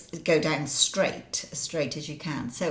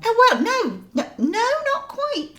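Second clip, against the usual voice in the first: coy denial; 7 dB louder than usual. Real, not acted. Correcting her friend.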